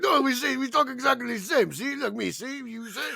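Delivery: mock French Canadian accent. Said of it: "And he's like".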